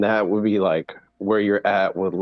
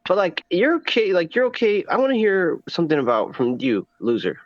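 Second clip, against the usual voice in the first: mocking high voice; 9 semitones higher than usual. Mocking impression continues, high pitch.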